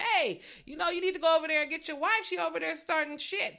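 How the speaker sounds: high, loud, fast mock call; imitating Mike, loud. Mike telling Candace's husband to get his wife, loud and high. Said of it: "Mike is just so Australian".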